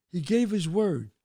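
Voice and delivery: ordinary talk, nothing marked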